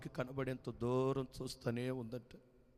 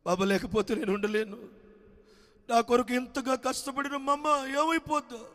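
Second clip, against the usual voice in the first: high, louder tearful pleading; son crying; 10 semitones higher, 7 dB louder than usual. A narrator's reading. Son weeping for the mother he abandoned, high and loud. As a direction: cry out for his lost mother. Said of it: "ఏడుస్తూ ఉన్నాడు".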